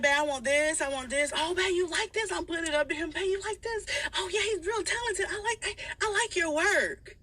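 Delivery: high, gushing fake praise; fake sweet voice. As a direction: lay the sweetness on thick. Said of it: "So I'm like".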